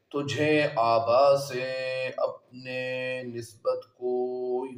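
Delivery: slow, measured poetry reading with drawn-out words; verse recitation. Acted. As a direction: recite the couplet phrase by phrase, lingering on words. Recites the verse before explaining it.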